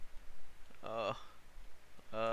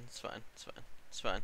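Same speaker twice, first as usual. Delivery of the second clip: barely audible resigned mutter; muttering to self; 8 dB quieter, faster than usual. Real, not acted. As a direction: trail off under your breath. Repeats to self after dying.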